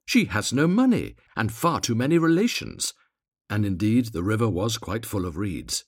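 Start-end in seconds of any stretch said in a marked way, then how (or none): 0.1-2.9 high, loud gossipy bird voice; twittering swallows. A narrator's reading. Other swallows disapproving of the reed, high twittering. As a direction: twitter disapproval, pitched well up. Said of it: "twittered the other swallows".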